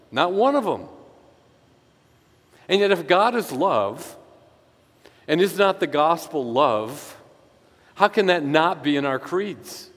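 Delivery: fiery sermon build; preaching at full force. Acted. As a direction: drive the point home from the pulpit. Preaching climax about creeds.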